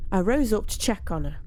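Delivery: usual speaking voice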